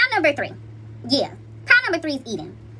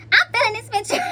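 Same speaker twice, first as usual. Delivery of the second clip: higher, excited, gushing; 9 semitones higher, 3 dB louder than usual. Real, not acted. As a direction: say it like you're thrilled with it. Repeats the line, building.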